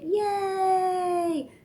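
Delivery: high loud held yay; cheering yay. Pretend. Parent cheering toddler's basket, loud high yay. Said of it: "they get a basket".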